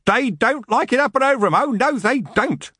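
high, gleeful crowing; cheering. A narrator's reading. Smack Tremble cheers as elves are hit, crowing. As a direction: crow it out high in triumph. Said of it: "Smack Tremble cheered".

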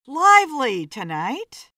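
loud, lively exclamation; exclaiming. A narrator's reading. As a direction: burst out with it, loudly. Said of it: "Kelly exclaimed".

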